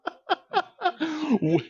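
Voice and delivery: high long laugh. Real, not acted.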